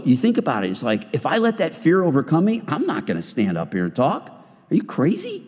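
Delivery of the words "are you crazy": higher, mock-incredulous yelp; incredulous scoff. Preacher scoffing at his own fear, high incredulous yelp. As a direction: toss it off like it's absurd.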